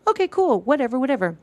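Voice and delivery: high-pitched brush-off, dropping slightly; dismissive. Retold or imitated. Retold dismissive reply to his excuses.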